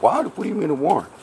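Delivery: a sudden high yelp of disbelief, then settling; shocked disbelief. Retold or imitated. Man hearing of his arrest warrant, shocked high-pitched question. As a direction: caught off guard by the warrant news.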